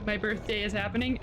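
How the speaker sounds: normal talking voice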